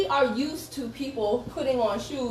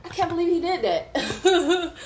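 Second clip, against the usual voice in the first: slow, higher, laughing through the words; a slower, higher line broken by laughter; laughing disbelief; 5 semitones higher than usual. Retold or imitated. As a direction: giggle it out in disbelief. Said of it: "I started laughing".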